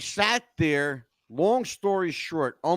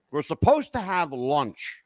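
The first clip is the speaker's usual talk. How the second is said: ordinary delivery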